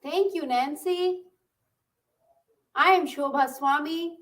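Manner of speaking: ordinary talk, nothing marked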